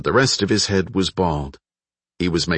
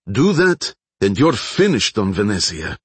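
raised, higher-pitched warning; called-out threat; 4 semitones higher, 3 dB louder than usual. A narrator's reading. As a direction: call out a threat, hardest on the opening words. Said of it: "Baklanov called".

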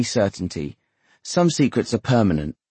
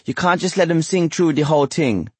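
higher-pitched, menacing Jamaican patois; threatening patois; 8 semitones higher than usual. A narrator's reading. Wife lapsing into patois at her husband, threatening. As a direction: slip into a scolding patois. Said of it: "lapsing into a threatening patois".

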